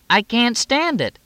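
very high, drawn-out protest; desperate. A narrator's reading. Moxie protests to Fletch that she must leave, desperate. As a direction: wail it slowly in exasperation.

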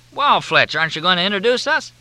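high, quick and hearty; pushy cheer. A narrator's reading. Gibbs pressing Fletch for an introduction, high and quick.

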